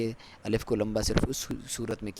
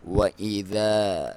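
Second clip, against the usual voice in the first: slow drawn-out tajweed chant; Quran recitation; 7 dB louder, much slower than usual. Shown as a demonstration. Teacher recites the verse for students, slow chant. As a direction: recite it slowly with full tajweed. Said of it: "یہاں پہ پھر مد جائز ہے".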